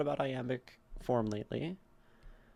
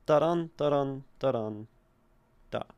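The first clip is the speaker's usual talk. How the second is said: da-dun with a light da and a punched dun; iambic rhythm demo; 5 dB louder than usual. Shown as a demonstration. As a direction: beat out da-dun, da-dun in a louder, regular pulse.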